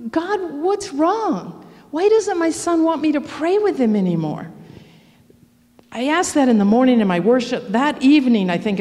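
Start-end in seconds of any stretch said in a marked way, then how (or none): 0.1-4.5 hurt plea. Retold or imitated. Mother asks God why her son rejects her, hurt. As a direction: ask God like it stings.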